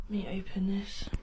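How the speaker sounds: tense unvoiced whisper. Real, not acted. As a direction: whisper it nervously. Whispering before opening curtain.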